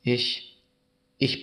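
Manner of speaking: understated, halting sob; sobbing with effort. A narrator's reading. Boy confessing to the Christ child, understated halting sobs. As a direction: softly struggle to get it out, lightly tearful. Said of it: "brachte unter den Schluchzen mühsam heraus".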